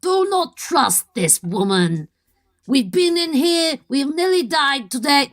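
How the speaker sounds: put-on slow, deliberate Orcish accent. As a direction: speak as orcs might, weighing each word. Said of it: "how orcs speak in my head".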